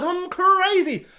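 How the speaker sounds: hammed-up crazed boast; manic villain. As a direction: savor the madness. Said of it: "he's like".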